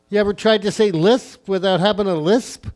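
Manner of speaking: usual speaking voice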